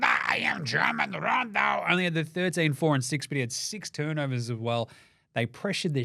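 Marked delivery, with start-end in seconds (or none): 0.0-1.8 mock announcer. Mocking Schröder's nickname.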